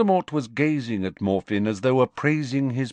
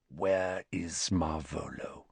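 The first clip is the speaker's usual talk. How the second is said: cold quiet question; 7 semitones lower, 9 dB quieter, slower than usual. Acted. Riddle questions the stranger.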